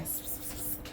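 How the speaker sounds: unvoiced psst to call the cat; soft psst-psst cat call; whispered psst calls. Real, not acted. Calling the cat over.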